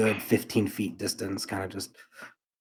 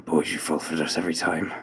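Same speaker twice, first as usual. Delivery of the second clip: low father voice; 5 semitones lower, 5 dB quieter than usual. Acted. Father character speaks to sons.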